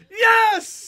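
high, loud celebratory yell; triumphant cheer. Real, not acted. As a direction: explode with joy at the clear. Cheering the cleared puck.

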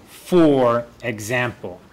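slow, "For" drawn out, then falling "example"; slow model phrase. Shown as a demonstration. Teacher introduces the phrase to students, slowly stressing "For". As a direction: stretch "For" and separate it from "example".

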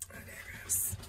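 breathy whispered "I digress" under the breath; whispered aside. Pretend. Muttering to herself while reading cards, whispered. Repeating aside, then varying it.

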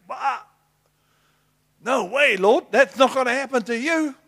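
high, loud outraged disbelief; shocked protest. Acted. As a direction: refuse it with loud alarm. Peter objecting to crucifixion.

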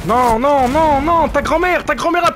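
high, loud protest; indignant shouting. Real, not acted. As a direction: yell back in outrage, very high. Protests hotly after being hit. (protestation criée, indignée)

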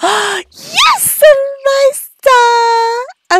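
high, slow, stretched cheer; drawn-out cheer. Acted. Cheering her Sim Eliza, high and drawn out. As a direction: hold the name out in delight. Cheering a Sim's birthday trait.

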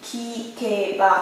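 lặp lại kikeba nhỏ và chậm; soft repeat. Shown as a demonstration. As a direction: say kikeba again, quietly and slowly, as a model. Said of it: "Càng nghe là gì ạ?".

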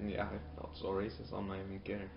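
ordinary delivery

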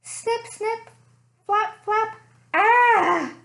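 high squeaky snip, snip, flap, flap, then a loud ah; bat noises. Acted. Bats at the barber shop at night, high squeaky sounds. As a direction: chirp it like tiny bats at the barber. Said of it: "is the bats visiting the barber shop".

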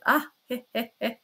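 dry, voiced "he, he, he" chuckle; sly chuckle. A narrator's reading. Porfiry needles the man he examines, sly chuckle. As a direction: chuckle slyly in separate little beats.

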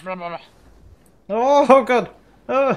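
high, loud panicked cries; panicked scream. Real, not acted. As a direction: cry out in sudden terror. Ambushed, panicked screaming in firefight.

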